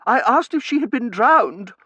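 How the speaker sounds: high, loud, mounting agitation; voice rising. A narrator's reading. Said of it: "she said, her voice rising".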